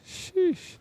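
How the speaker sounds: high squeaked "sheesh"; quoted "sheesh". Retold or imitated. Streamer quoting their own sheesh to chat, high squeak. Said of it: "I get a sheesh when I said".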